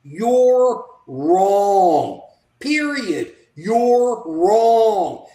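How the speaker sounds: loud, firm declaration; emphatic. Retold or imitated. Voicing the minority telling the majority, firm and loud. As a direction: lay it down hard and final. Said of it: "the right to say to the majority".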